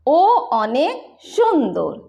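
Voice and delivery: slow Bengali model, सुंदर as "shondor"; rounded Bengali vowels, sh in सुंदर; Bengali pronunciation. Shown as a demonstration. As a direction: say ओ अनेक सुंदर slowly as "o onek shondor". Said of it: "Bangla में".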